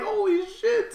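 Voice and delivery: very high retold exclamation; high-pitched mock shock; astonished. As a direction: squeal it in amazed disbelief.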